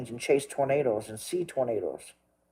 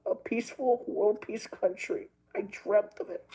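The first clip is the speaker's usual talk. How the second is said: higher, softer, tearful and strained; crying voice; 5 semitones higher, 3 dB quieter than usual. Real, not acted. Vlogger tells viewers his childhood dream died, crying. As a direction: voice cracking as he laments his dream. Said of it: "Look, I'm crying".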